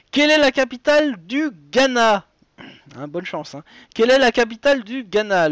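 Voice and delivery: animateur de quiz qui énonce la question et les choix; présente chaque réponse comme un jeu télé; lecture de quiz. Acted. Host reads quiz question. (voix d'animateur de jeu, un peu plus fort)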